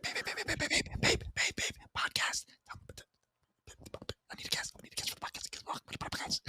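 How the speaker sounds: urgent, unvoiced pestering whisper; whispering in her ear. Husband nagging wife in her ear mid-call, frantic whisper. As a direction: whisper it over and over, insistently. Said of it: "That's all I'm doing in her ear".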